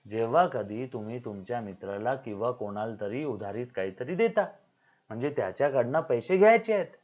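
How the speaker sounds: ordinary delivery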